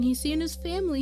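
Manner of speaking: ordinary talk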